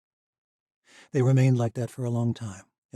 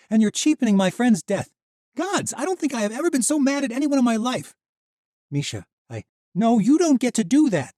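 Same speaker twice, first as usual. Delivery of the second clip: high, loud furious accusation; angry; 9 dB louder than usual. Acted. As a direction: tear into him, fast at the end. Misha's rising fury.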